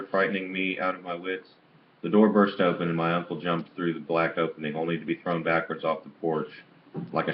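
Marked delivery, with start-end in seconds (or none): none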